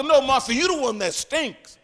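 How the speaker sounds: playful telling-off with a laugh; mock scolding. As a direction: snap back at Martha jokingly. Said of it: "I would have checked Martha".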